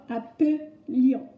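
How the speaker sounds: slow "appelions", broken as "a-peu-lions"; syllable-by-syllable "appelions" with schwa. Shown as a demonstration. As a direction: draw out the "euh" in "appelions". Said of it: "comme un E, tout simplement".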